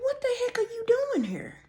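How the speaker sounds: high, shocked squeal of disbelief. Retold or imitated. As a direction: caught off guard, voice jumps way up. Shocked at ex at door.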